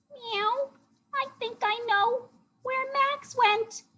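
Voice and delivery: very high, squeaky cat voice; high cartoon kitty; cat character. A narrator's reading. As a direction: meow it in a tiny high voice.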